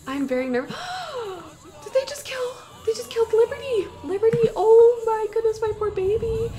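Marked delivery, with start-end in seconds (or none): 0.8-1.8 long, high intake of breath; horrified gasp. Real, not acted. Sees a family member killed. 1.8-6.5 high, wailing disbelief; distraught shock. Real, not acted. Her game pet is killed.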